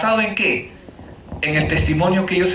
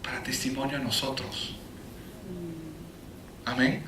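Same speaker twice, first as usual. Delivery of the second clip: cierre solemne; 7 semitones lower, 13 dB quieter than usual. Real, not acted. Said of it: "cierre enfático, muy bajo".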